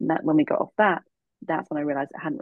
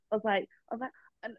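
normal talking voice

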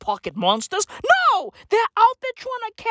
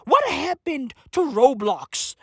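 usual speaking voice

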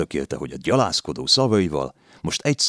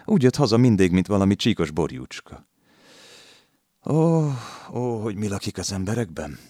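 lamenting landlady; 5 semitones higher than usual. A narrator's reading. Landlady laments the arrested lad, higher. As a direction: wring your hands over him.

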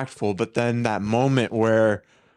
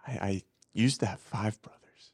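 quiet quoted line; 4 semitones lower, 5 dB quieter than usual. Retold or imitated. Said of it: "Zac Efron's like".

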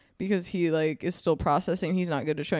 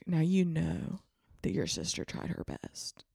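mock Western accent; 2 semitones lower, 5 dB quieter than usual. Wife urging husband to help the boy, quiet Western accent. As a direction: coax him like a ranch wife in a Western accent. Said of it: "I don't know what accent they have in Wyoming".

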